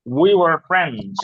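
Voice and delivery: We were friends drawn out very slowly, each word separate; slow model sentence. Shown as a demonstration. Teacher drilling We were friends very slowly for the class to repeat. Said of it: "বলেন সবাই".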